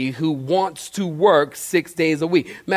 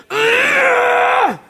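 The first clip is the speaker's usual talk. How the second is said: high, strangled cartoon groan of fury; mock angry groan; 4 dB louder than usual. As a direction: choke out a comic furious grunt. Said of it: "they're probably like".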